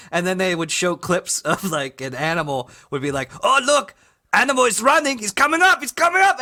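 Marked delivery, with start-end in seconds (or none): 3.4-6.4 excited Russian commentator, loud and rising; high, mounting Russian-accented play-by-play. Acted. Russian host watching an animal run, excited Russian accent. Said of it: "like, a Russian guy".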